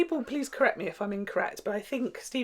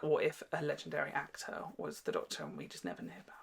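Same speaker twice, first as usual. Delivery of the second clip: hushed whisper; 5 semitones lower, 8 dB quieter than usual. Retold or imitated. Moffat's idea told hushed.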